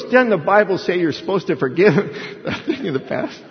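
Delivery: put-on high kid's retort breaking into laughter; imitated son. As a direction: laughingly mimic a child's challenge. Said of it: "He goes".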